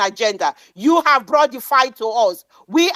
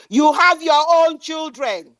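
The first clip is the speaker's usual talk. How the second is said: usual speaking voice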